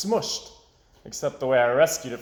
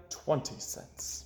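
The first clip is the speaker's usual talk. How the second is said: slow whispered farewell; whispering; 10 dB quieter, much slower than usual. A narrator's reading. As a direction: breathe it out sadly.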